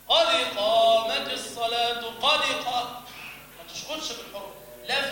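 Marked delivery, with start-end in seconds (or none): 0.1-3.0 قد with the dal bounced hard and loud, slowly; mock over-bounced قد in the iqama; overdone qalqala. Shown as a demonstration. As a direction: exaggerate the echo on the dal of قد. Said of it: "تقيم للصلاة كذا".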